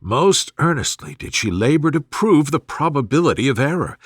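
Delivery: ordinary delivery, nothing marked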